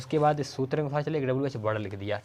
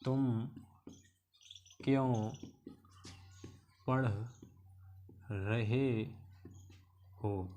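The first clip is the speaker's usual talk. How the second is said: slow dictation; 6 dB quieter, much slower than usual. Shown as a demonstration. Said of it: "teacher dictates slowly word by word".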